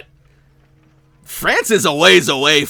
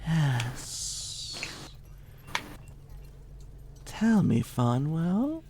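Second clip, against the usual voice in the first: soft menace; 3 semitones higher, 9 dB quieter, slower than usual. Acted. Vampire probes softly.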